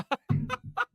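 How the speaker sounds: squeaky burst of laughter; high laugh. Real, not acted. Laughing at the clip.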